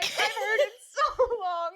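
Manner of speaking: high, slow words through laughter; laughing hard. Real, not acted. Co-host reacting to the meme, laughing hard. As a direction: squeeze it out while cracking up.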